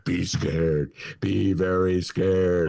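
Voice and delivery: slow, low, spooky warning; ominous mock warning. Host mocking a scare campaign, slow and spooky. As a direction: intone it like a horror trailer. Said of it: "that's what they're gonna do".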